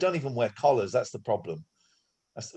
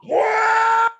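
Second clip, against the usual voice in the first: high shocked yelp; shocked shout; 8 dB louder than usual. Real, not acted. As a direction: cry out in disbelief. Shocked at the profit.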